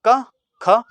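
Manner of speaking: क with no breath, then ख with a strong puff of air; k then kh demo. Shown as a demonstration. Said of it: "उसके बाद उच्चारण करें आप ख". (क बिना हवा, ख ज़ोर की हवा के साथ)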